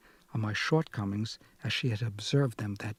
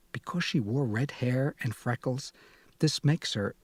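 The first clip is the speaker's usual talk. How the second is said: ordinary delivery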